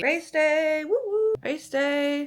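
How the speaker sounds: high excited race day cheer. Real, not acted.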